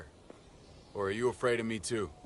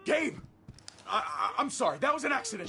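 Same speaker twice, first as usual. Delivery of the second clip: panicked; 13 semitones higher than usual. Acted. Shot his son, panicking.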